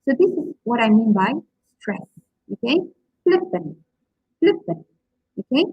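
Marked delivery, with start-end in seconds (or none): 3.2-4.9 FLIP-pant, high on FLIP, falling on -pant; voice up, then down. Shown as a demonstration. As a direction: jump up on FLIP in flippant, then drop. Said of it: "the voice goes up and down".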